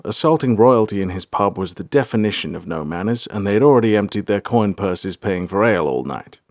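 usual speaking voice

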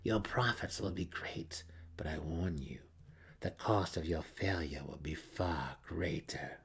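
low menacing whisper; a soft threat that chills; threatening whisper. Acted. Malice warning the mercenary in a menacing whisper. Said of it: "she growled".

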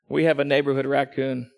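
ordinary talk

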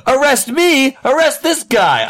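high-pitched indignant yell; outraged retort. Acted. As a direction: throw the blame back loudly. Acted quarrel with the troopers.

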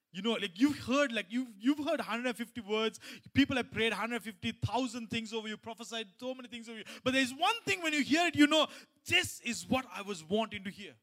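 high, loud pulpit delivery; loud, high-pitched preaching; preaching at full force. Real, not acted. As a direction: drive the point home to the congregation.

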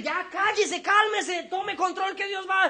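high-pitched, urgent commands; agitated shushing. Retold or imitated. Pastor orders the jumping father to calm down, agitated. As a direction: talk down a frantic man at his own pitch.

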